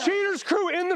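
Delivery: high, stretched-out yell across a distance; urgent shouted order. Real, not acted. Host evacuating crew from private property, urgent holler.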